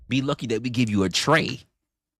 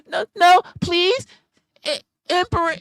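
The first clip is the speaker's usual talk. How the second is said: high squeaky pleading, much louder; mock begging falsetto; 10 dB louder than usual. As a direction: beg in a hammed-up falsetto. Mocking a begging woman.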